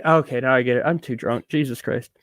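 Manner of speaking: ordinary delivery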